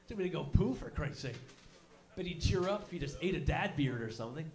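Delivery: usual speaking voice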